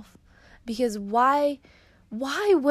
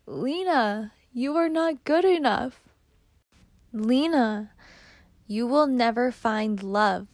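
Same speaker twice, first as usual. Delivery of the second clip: put-on halting, pained words to a child; a touch of heartbreak, addressed to her; speaking to daughter; 2 semitones higher than usual. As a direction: pause after the name, lift the pitch reluctantly. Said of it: "Read these off as if you are speaking to Lena".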